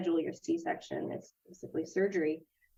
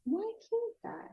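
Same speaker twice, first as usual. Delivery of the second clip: mock plaintive question, much higher and slowed; whiny self-quote; 3 dB quieter, slower than usual. Asking herself why she can't run, much higher and slower. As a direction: squeak it out like a sulky kid. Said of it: "So I was like".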